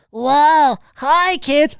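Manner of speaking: high, loud, nasal-free kiddie host voice; squeaky kids'-show greeting; Pee-wee impression. Streamer mocks Pee-wee greeting kids, high and loud. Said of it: "the whole".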